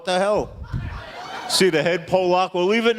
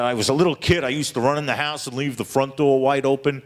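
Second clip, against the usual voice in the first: ordinary talk, nothing marked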